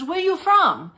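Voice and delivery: high, loud vendor's broken English; pushy vendor in broken English; mock broken-English vendor. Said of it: "She goes".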